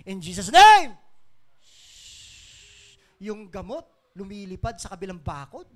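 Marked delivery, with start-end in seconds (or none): 0.1-1.0 shouted, rising declaration of faith; loud declaration. Retold or imitated. Preacher retelling a bold faith declaration, sudden shout. Climax of own retold declaration. 1.6-3.0 long airy shushing noise; imitated whoosh. Preacher showing medicine flying over the fence, airy whoosh.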